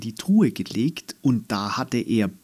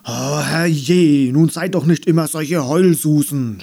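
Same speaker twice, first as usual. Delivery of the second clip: loud, high scolding grumble; 4 semitones higher, 6 dB louder than usual. Acted. The Nicht-Einhorn scolds the whining friends loudly. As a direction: tell the crybabies off loudly. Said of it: "schimpfte das Nicht-Einhorn".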